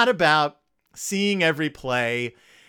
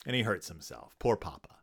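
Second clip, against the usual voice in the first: much softer, lower sympathy; softer, lower mock sympathy; mock pity; 9 semitones lower, 11 dB quieter than usual. Real, not acted. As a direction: mutter it with fake sorrow.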